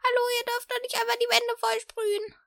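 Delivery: high scolding woman; indignant lady calling out; shouting at trespasser. Pretend. [name] voices the scolding woman. (empörte Frau ruft hoch)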